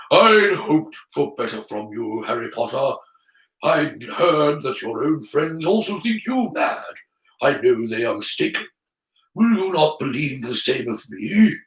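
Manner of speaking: put-on old wizard voice, mildly disappointed; sorrowful Dumbledore. Dumbledore let down by Harry Potter, sorrowful. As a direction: reproach with understated sorrow. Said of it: "shook his head in sorrow".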